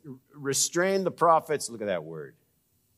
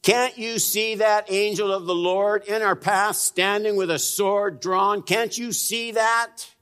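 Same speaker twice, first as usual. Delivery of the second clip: high, insistent rebuke; raised pitch, scolding in disbelief; indignant talking donkey; 7 semitones higher, 3 dB louder than usual. Acted. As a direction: plead loudly like an exasperated donkey. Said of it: "The donkey says this".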